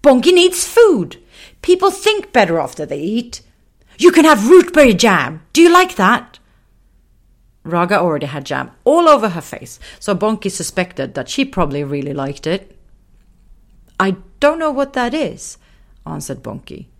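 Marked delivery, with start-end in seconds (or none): 0.0-6.4 very high, loud lion, cheerful offer; bubbly little lion. Acted. Raga offers food. 14.0-15.6 put-on higher little girl, unsure; puzzled girl. Bonky replies.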